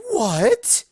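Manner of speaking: high, loud shocked shriek. Acted. Rarity hearing a date is no big deal, shrieking. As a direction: shriek in disbelief. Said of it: "Rarity shrieked".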